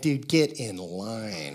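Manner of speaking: low, soft, very drawn-out brush-off; weary dismissal. Retold or imitated. Pastor answering someone wounded in church, slow dry dismissal. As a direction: stretch it out slowly and sink in pitch.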